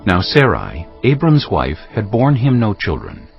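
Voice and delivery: usual speaking voice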